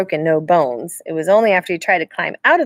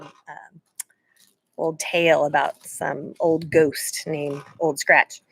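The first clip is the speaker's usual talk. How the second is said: plain reading voice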